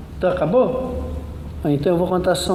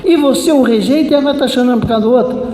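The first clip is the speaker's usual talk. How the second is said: fast, high-pitched loud scolding; loud rebuke; 6 semitones higher, 4 dB louder, much faster than usual. Retold or imitated. Preacher scolds girl for rejecting Christ's love, loudly indignant. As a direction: rattle off an indignant reproach. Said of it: "e falei alto".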